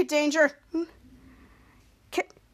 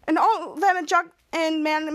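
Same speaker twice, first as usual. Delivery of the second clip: ordinary talk, nothing marked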